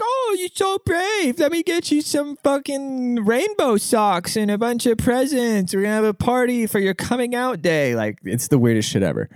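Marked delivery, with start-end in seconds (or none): 0.0-8.0 gushing mock praise. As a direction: coo it like a doting fan. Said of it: "everybody's like".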